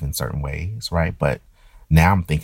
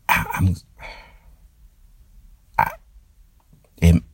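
halting, stuttering start. Real, not acted. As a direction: trip over the first words. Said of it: "I'm stuttering".

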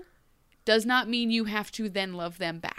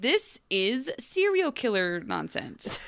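deadpan disgust; 5 semitones higher, slower than usual. Retold or imitated. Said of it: "I'm like".

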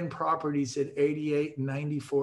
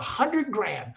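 usual speaking voice

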